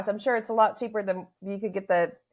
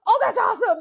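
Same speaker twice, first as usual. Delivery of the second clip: imitated excitement; 13 semitones higher, 7 dB louder than usual. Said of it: "And I was like".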